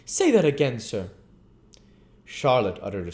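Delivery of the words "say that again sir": louder, higher pompous outrage; indignant beadle. Acted. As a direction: demand it, affronted. Bumble bursts in angry.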